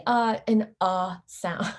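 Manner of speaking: a short neutral "uh" sound; schwa uh sound. Shown as a demonstration. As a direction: say "uh" relaxed and short. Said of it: "it's simply".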